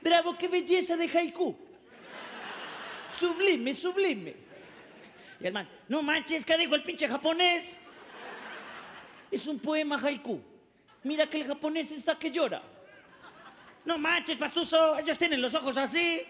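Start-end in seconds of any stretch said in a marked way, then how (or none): 0.0-4.4 much higher, affected praise; pretentious admirer. Retold or imitated. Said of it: "aplaude para no parecer ignorante". 5.9-16.2 higher Mexican voice, lightly done; Mexican accent. Retold or imitated. Mexican slang "no manches","pinche.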